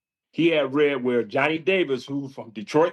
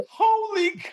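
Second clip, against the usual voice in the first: high, stunned holy cow; retold shock. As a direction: gasp it out in disbelief, pitched way up. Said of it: "I was like".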